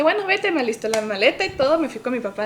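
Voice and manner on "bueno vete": high, loud snappy mom; mom's curt retort. Retold or imitated. Mom telling her daughter to leave, high and snappy. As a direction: mimic mom snapping back, pitched way up.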